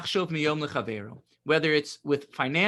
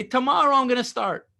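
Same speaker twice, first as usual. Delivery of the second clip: procrastinator's voice; 7 semitones higher, 6 dB louder than usual. Retold or imitated. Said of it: "you're not gonna say".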